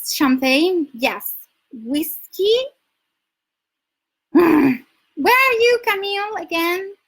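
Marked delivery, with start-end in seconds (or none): 4.3-6.9 higher, louder, slow call to a viewer. Real, not acted. Asking viewer Camille where she is from, calling out loud and slow. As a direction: call her out across the room.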